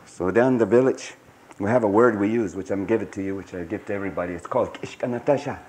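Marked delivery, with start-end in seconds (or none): none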